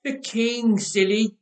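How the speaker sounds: put-on cheeky, grinning kid voice; grinning child. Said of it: "the little boy grinned".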